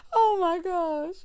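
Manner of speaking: high, slow, drawn-out disbelief; amused disbelief. Real, not acted. Reacting to the cast member's line, high and drawn out. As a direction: stretch it out, still amused. Still reacting after laughing.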